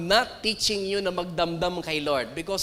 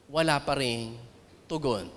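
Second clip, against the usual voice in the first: somber hush; 8 semitones lower, 6 dB quieter than usual. Acted. Somber point: prayer unanswered.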